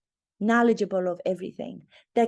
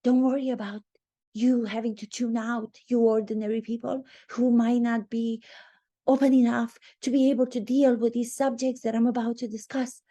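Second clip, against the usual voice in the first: higher, patronising mock voice; mocking a condescending podcaster; 4 semitones higher than usual. Mocking her telling ordinary listeners not to tune out. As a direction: talk down sweetly to the ordinary people. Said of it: "she goes on to say".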